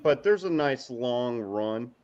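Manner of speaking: ordinary talk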